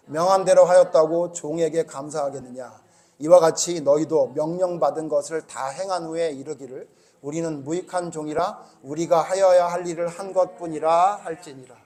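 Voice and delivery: scripture reading. Acted. Pastor reads the verse together with the church, measured and low. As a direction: read the verse steadily with the congregation.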